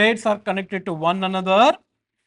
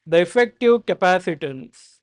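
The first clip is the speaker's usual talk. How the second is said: dictating. Shown as a demonstration. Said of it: "Next note".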